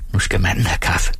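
usual speaking voice

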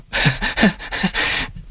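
high hysterical giggling; helpless high laughter; uncontrolled laugh. A narrator's reading. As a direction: laugh on and on against your will. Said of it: "Hvor er det dumt, at jeg ler".

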